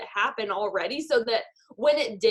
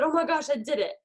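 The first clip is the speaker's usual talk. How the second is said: imitating thrilled call; 4 semitones higher than usual. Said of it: "called on the phone and said".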